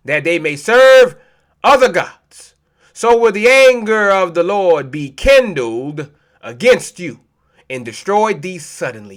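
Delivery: scripture reading. Acted. Reads scripture aloud.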